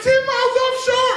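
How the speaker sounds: high, loud put-on daughter voice; mock daughter. Daughter on the phone to dad, high and loud. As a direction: pitch it way up, calling back. Daughter answering her shouting father.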